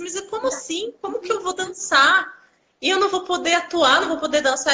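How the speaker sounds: high-pitched, disbelieving protest; re-enacting a panicked plea, pitched well up; retold panic. Patient to doctor who says she may not walk, panicked. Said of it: "eu entrei em pânico, eu comecei a chorar".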